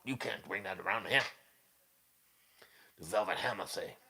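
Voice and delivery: soft put-on Velvet Hammer accent; Bad Brad accent. Velvet Hammer refusing to allow it, soft mock accent. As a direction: mimic his accent in short, quiet refusals. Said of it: "the Rico Beato faces and the Bad Brad accent".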